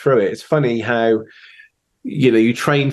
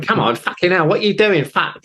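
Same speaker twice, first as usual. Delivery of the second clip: imitating an angry boss; 7 semitones higher than usual. Singer snapping at drummer onstage, mimicked annoyed scolding. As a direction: toss off a retold angry telling-off. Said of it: "she's going".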